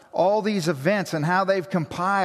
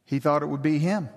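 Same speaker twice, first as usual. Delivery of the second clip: ordinary talk, nothing marked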